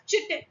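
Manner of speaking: chitin read as it is spelt, "CHIT-in"; mispronounced chitin. Shown as a demonstration. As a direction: say chitin with CH as in chip. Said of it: "I was also pronouncing it as".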